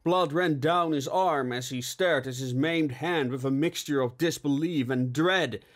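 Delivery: usual speaking voice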